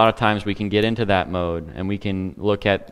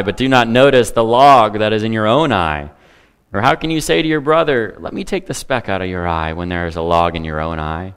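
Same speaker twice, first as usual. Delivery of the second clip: scripture reading; 2 semitones higher, 6 dB louder than usual. Acted. Reads scripture aloud.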